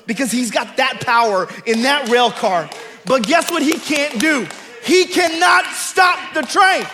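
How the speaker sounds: building to a loud, high preacher's shout; high, booming sermon peak; full-force preaching. Real, not acted. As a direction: preach it up to a ringing, triumphant climax. Preaches to a climax.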